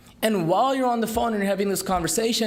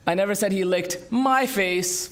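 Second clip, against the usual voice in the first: "my" punched, the rest light; stress on MY. Shown as a demonstration. Speaker demoing word stress to an audience, stressing "my". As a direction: contrast "my" face with someone else's. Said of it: "emphasize different words".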